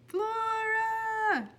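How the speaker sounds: high, loud call across the night. Acted. The lost superhero calls out for Flora, high and loud. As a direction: call her name into the dark.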